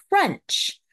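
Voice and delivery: a sudden high, loud "Crunch!"; crunchy bite sound. Pretend. Narrator to children, acting out an apple bite, high and loud. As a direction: pop out "Crunch!" like biting an apple.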